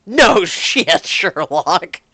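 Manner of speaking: very loud, explosive retort; mad sarcastic yell. Acted. Woman answers partner asking if she's mad, yelling angrily. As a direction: shout it with scornful force. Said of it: "You are damned right I am mad".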